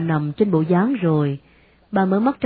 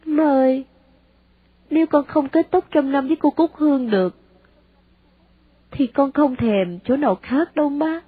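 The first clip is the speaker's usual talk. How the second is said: teary-eyed; 6 semitones higher than usual. A narrator's reading. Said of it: "rưng rưng nước mắt mà nói".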